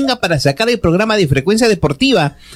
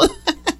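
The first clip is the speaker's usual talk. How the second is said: laugh; 12 semitones higher, 10 dB quieter than usual. Real, not acted. Laughs at menopause joke.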